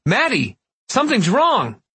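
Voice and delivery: high panicked shout up the stairs. Acted. Father calling his wife in alarm, panicked high shout. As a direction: call for help, pitch shooting up. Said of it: "my dad started shouting".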